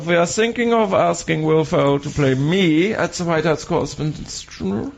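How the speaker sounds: put-on slow, careful German-accented impersonation. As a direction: play the caller with a thick comic German accent. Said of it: "the German thing".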